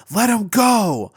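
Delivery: high, loud heroic yell. Acted. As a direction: shout it as you charge in. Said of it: "Deku would yell out".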